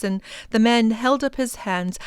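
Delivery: ordinary delivery, nothing marked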